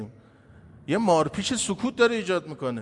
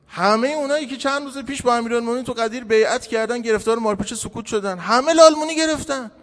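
raised-pitch, urgent preaching; fervent exhortation; 7 semitones higher, 3 dB louder than usual. Real, not acted. Preacher urging listeners to stop fearing and speak out, fervent exhortation. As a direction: press the congregation with pointed challenges.

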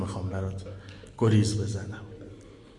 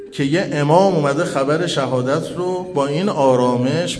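louder, higher, rising elegy; mourning lament; 6 semitones higher, 5 dB louder than usual. Acted. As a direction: build the grief toward a wail for the listeners.

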